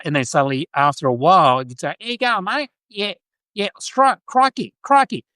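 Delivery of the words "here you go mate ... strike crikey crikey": high-pitched, hammed-up broad Australian accent; Steve Irwin Aussie accent. Showing how Australians ended up talking, broad Aussie accent. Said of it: "to talking like Steve Irwin".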